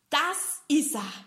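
high, slow and proudly presented; proud mouse. Acted. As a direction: show off the photo proudly. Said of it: "sagt stolz". (stolz, hoch und langsam)

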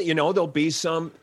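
normal talking voice

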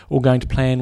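ordinary talk

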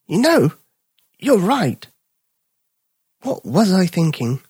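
high, slow dawning dog voice; little dog realising. Acted. Little dog agreeing with Bipper, slow high voice. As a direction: let it sink in slowly.

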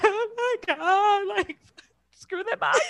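high, slow, laughing gush; laughing delight. Retold or imitated. Finally seeing the ring, gushing through laughter. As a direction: melt into giddy laughter. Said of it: "And I was like".

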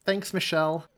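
usual speaking voice

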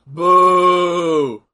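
long, loud booing. Real, not acted. Fan heckles the match, loud drawn-out boo.

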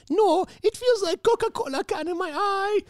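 put-on very high, sustained child's wail; hammed-up screechy little-boy complaint; crying kid, worse. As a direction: wail it like a kid in escalating agony. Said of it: "it becomes, like, worse for him".

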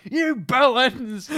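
slow, loud, high yell; shouting. Retold or imitated. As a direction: bellow the insult at them. Dad scolding kids.

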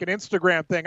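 ordinary delivery

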